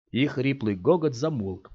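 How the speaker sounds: plain reading voice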